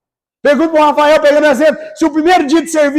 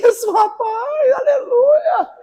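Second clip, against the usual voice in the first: much higher, joyful cry at his own muscles; amazed cry; 11 semitones higher, much slower than usual. Pretend. Future self seeing his biceps, high joyful cry. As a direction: shout aleluia in delighted amazement. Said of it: "vai falar".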